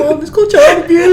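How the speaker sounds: high, loud, laughing half-crying whine; mock whine. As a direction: wail it through laughter. Mock complaint to mom.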